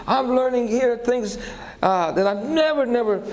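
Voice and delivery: high excited quote of the woman; woman marveling, voiced high; excited woman quoted. Woman's praise retold.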